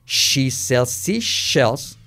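very slow "She sells seashells" with long sh and crisp s; word-by-word demo of "She sells seashells"; SH against S contrast. Shown as a demonstration. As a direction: stretch each sibilant in "She sells seashells". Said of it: "Hacemos la sh y luego la s".

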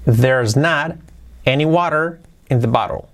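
broken into slow chunks; careful teaching take; slow model. Shown as a demonstration. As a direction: pause after "not" and separate the phrases. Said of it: "luego un poquito más rápido".

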